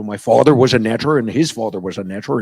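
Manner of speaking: Cornish accent with strong R's; broad Cornish demo. Shown as a demonstration. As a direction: lean on the R's like Cornwall. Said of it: "down in Cornwall, they start saying the R's".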